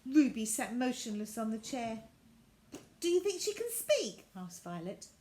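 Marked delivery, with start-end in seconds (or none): 3.0-4.2 put-on much higher girl's voice; bright child's voice; curious girl. Violet meeting the new rag doll, high child voice.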